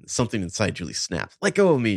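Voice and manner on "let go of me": high, lightly raised outcry; a mock yell, pitched up; mild narrated scream. A narrator's reading. Cornered Julie snapping at her friends, mild scream. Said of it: "she screamed".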